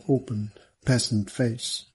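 ordinary delivery

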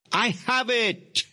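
high, exultant cry, mildly screamed. A narrator's reading. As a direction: cry it out in high-pitched triumph. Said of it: "she screamed exultantly".

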